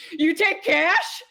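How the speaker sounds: high, loud, drawn-out actor impression; Tim Curry. Player quoting Pa's line from the film, hammed-up excited impression. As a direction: stretch the question out, hammed-up and eager. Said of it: "Tim Curry has the best s-, saying of this line ever".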